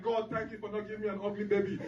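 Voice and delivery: lightly acted tearful gratitude; mock tearful wife. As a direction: imitate a wife weeping thanks to God. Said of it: "wife is crying like".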